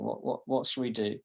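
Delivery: ordinary talk, nothing marked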